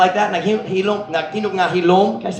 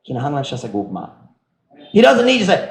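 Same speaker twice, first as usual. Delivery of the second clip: usual speaking voice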